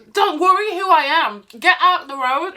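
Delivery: mildly exasperated mock order; retold retort. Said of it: "And I'm like".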